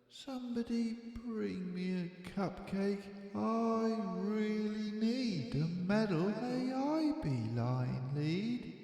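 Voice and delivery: soft sleepy demands. A narrator's reading. Sleepy cub murmuring wishes from bed. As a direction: mumble the wishes half asleep.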